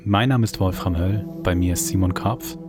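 usual speaking voice

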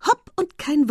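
loud, high motherly command; almost motherly. A narrator's reading. Estelle urges her carrier on, almost motherly. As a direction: hush him briskly like a mother. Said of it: "Es klang schon fast mütterlich".

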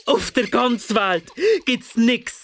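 high-pitched outraged complaint; affronted monster's rant; indignant. A narrator's reading. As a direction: rail against the unfairness of it. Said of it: "rief der Jark empört".

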